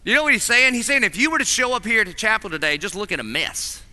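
ordinary talk

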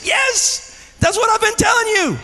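high, loud exclamation; excited mother, imitated. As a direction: answer a child with delighted emphasis. Acted wife's excited reply to child.

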